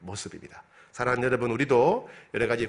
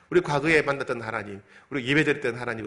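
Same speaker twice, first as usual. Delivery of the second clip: ordinary talk, nothing marked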